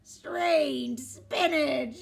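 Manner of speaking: loud, very slow wail; wailing pirates.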